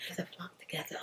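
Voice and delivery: breathy whisper, under the breath; whispered aside. Shown as a demonstration. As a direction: mutter the saying to yourself in a whisper. Whispered repeat of the saying.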